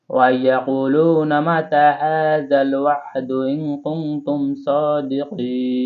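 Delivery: very slow melodic tilawat; slow sung Quran recitation. Shown as a demonstration. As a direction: draw each word out in recitation. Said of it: "আপনারাও আমার সাথে সাথে পড়ুন".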